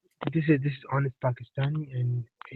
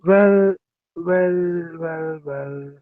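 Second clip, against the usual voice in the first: fading mock-knowing "well, well, well", starting loud; mock gloating; 3 semitones higher, 4 dB louder, much slower than usual. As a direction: open big on "Well", then let each one sink.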